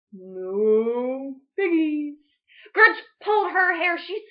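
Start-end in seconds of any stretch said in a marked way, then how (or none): 0.1-2.2 very slow, lowing no piggies; cow voice. Acted. The cow answering Grinch, very slow. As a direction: moo it out.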